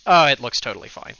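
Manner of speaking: ordinary talk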